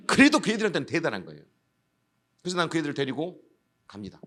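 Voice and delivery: usual speaking voice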